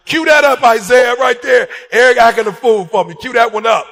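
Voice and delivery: loud, excited calls to record; gleeful directing. Retold or imitated. Wife loudly telling Isaiah to cue up the recording, excited. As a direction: hype up the cameraman.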